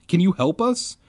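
high, pressing question; demanding. A narrator's reading. Lost narrator to gray kids, demanding. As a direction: press them for help. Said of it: "I demanded".

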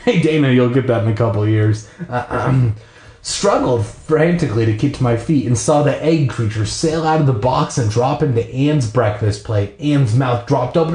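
ordinary delivery

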